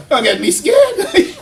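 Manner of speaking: laughing high, drawn out. Real, not acted. Teacher laughing at silent students, stretching the words. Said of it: "Okay, y'all get me scared now".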